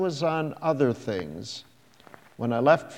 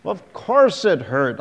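louder, higher sharp snap; snapped; 4 semitones higher, 6 dB louder than usual. A narrator's reading. Man snapping at the girl about his grief, loud and sharp. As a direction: bite the words off at her. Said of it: "I snapped".

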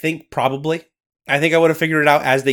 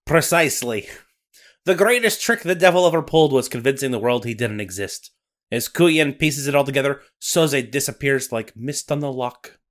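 put-on Scottish accent. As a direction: narrate like a Highland storyteller. Nessie narration.

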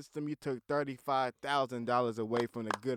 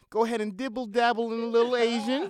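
hyped-up riff; 11 semitones higher, 7 dB louder than usual. Acted. Riffing on dating other races.

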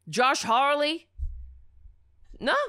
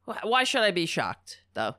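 ordinary talk, nothing marked

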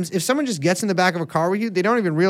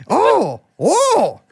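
bad Irish accent; 5 semitones higher than usual. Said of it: "you have to say the Lucky Charms".

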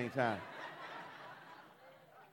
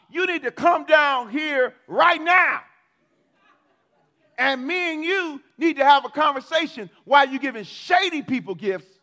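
shouting demand; 9 semitones higher, 8 dB louder than usual. Retold or imitated. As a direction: yell up at God. Retold demand shouted at God.

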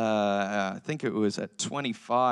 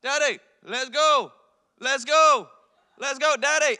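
put-on very high, slow toddler call; imitated toddler; 11 semitones higher, 4 dB louder than usual. Toddler son waking dad with new words, imitated demanding call. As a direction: chant it like an impatient small child summoning a parent. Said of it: "I wake up to".

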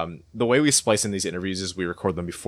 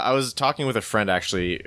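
ordinary talk

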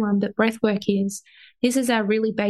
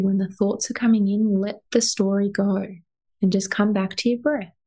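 guided meditation. Acted. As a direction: guide them gently into their breath. Leads a breathing meditation.

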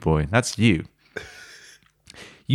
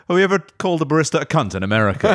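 fast mocking quote; 9 semitones higher, much faster than usual. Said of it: "It was like".

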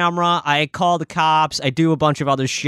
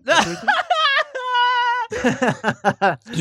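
squealing laugh, high and breathless; high wheezing laugh. Real, not acted. Reacts to the story.

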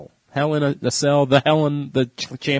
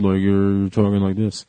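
slow, muffled, deeper talk; 6 semitones lower, slower than usual. Shown as a demonstration. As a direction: talk like through cloth.

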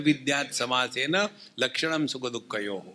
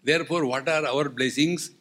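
normal talking voice

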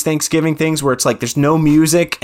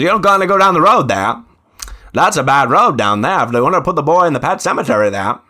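put-on Herman Munster Maine accent; 2 semitones higher, 5 dB louder than usual. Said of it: "Herman Munster in, uh, in Pet Sematary".

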